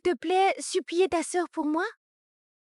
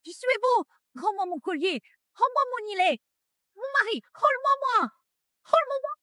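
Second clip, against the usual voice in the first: higher, frantic begging; desperate plea; 6 semitones higher than usual. Acted. Daughter begging her fiancé for the necklace, frantic. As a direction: claw for it, voice rising.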